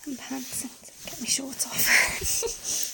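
breathy close-mic whisper. Acted. Whispered intimate roleplay throughout.

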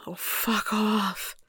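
slow, drawn-out moan. Acted. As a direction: stretch it into a moan. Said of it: "I moan out a".

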